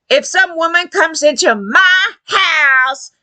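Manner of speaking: mocking impression of belligerent entitlement; mimicked defiant men. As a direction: imitate a man insisting, word by word. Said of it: "They're like".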